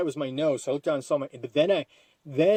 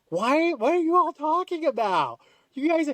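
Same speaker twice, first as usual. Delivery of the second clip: much higher, slow, incredulous; baffled high protest; 13 semitones higher than usual. Retold or imitated. Asking the food truck crowd what they mean, high incredulous squeak. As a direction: squeak it out in disbelief.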